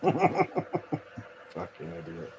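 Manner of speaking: laughing through the insult; giggling put-down. Real, not acted.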